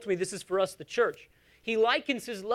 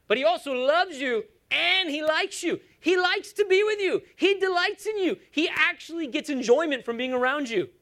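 high, emphatic sermon peak; fervent preacher in full flow; impassioned preaching; 8 semitones higher, 3 dB louder than usual. Real, not acted. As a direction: drive it home to the congregation, pitched high.

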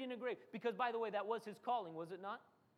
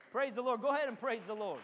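ordinary talk, nothing marked

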